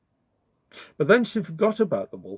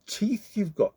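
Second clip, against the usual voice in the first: squeaked; 9 semitones higher than usual. Acted. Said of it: "she squeaked".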